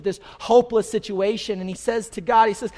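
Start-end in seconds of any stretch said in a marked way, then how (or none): none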